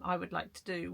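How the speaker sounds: normal talking voice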